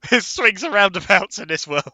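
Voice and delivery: normal talking voice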